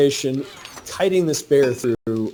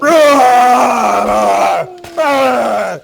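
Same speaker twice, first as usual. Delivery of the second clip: higher, louder rah rah rah war whoops; Rambo battle cry; 9 semitones higher, 8 dB louder, much slower than usual. Retold or imitated. Friend charges the bear like Rambo, shouting war cries. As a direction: yell like Rambo charging in with guns. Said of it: "goes out there like fucking Rambo".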